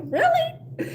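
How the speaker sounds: a high, squeaky disbelieving really; mock shock at his nerve; incredulous. Still heated at her friend's excuse, incredulous. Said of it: "it still gets me heated".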